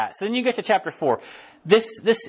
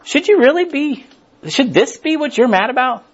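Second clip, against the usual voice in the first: God asking; 7 semitones higher, 6 dB louder than usual. Acted. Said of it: "He's just like".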